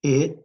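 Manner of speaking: "it" as short "ih" then a separate "t"; sounded out for blending. Shown as a demonstration. Teacher blending for students, "it" sounded out with short i. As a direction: sound out "it" letter by letter. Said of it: "we were blending this word".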